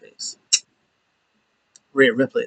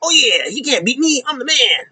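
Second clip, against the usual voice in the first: high mock trash talk, much higher; boasting in a put-on wrestler voice; cocky wrestler boast; 11 semitones higher than usual. Wrestler gloating over his win, high mock boast.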